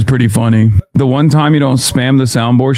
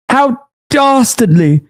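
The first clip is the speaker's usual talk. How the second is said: slow, high-pitched British put-on; mock British accent; 9 semitones higher, slower than usual. Streamer mocking a British accuser, hammed-up British accent. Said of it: "That British accent is actually somewhat good".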